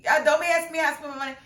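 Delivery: imitating Marceau caught off guard; mocking Marceau. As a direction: rattle it off, flustered and defensive. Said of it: "He was like".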